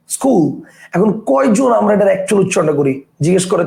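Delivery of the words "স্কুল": "স্কুল" starting straight on the s; actual pronunciation. Shown as a demonstration. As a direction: say "স্কুল" as skul, no vowel first. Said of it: "এটার actual উচ্চারণটা কি".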